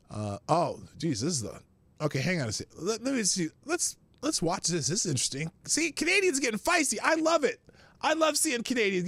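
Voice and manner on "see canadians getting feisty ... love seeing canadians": high, bright-eyed glee; delighted. Real, not acted. Streamer delighted watching Canadians argue with police, excited high. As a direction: cheer on the feisty Canadians.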